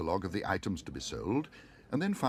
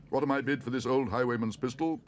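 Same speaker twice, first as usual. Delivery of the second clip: louder auctioneer's call; 5 semitones higher, 5 dB louder than usual. A narrator's reading. Auctioneer offers the pistol to bidders. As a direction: sell it to the room. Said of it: "called the auctioneer".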